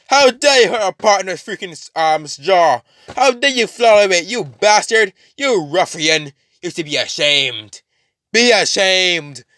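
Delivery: high-pitched mock outrage; hammed-up affronted rant; indignant scolding. Carnage scolds while stabbing.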